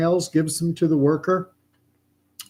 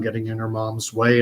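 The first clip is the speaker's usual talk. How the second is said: normal talking voice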